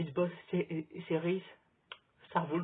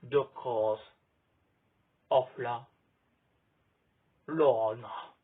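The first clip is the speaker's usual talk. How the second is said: deep, loud attempt at an English accent; 7 semitones lower, 6 dB louder, slower than usual. Shown as a demonstration. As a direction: drop low and fake an English accent for the title. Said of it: "J'ai pas l'accent anglais encore".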